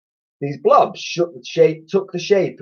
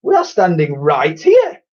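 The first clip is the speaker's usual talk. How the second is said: higher, louder put-on twin voice; indignant twin; 5 semitones higher, 5 dB louder than usual. A narrator's reading. Twin telling the boy they are right here, indignant. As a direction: say it with prissy offence. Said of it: "added Edmund".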